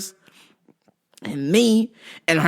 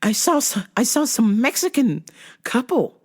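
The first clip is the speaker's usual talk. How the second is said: put-on old lady voice; 6 semitones higher than usual. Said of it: "she's like".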